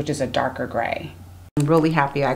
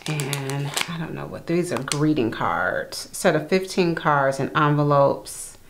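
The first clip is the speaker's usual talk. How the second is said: normal talking voice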